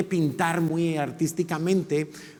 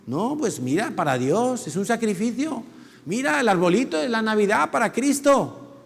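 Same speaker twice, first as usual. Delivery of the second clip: excusing Saul; 6 semitones higher than usual. Retold or imitated. Saúl excuses himself.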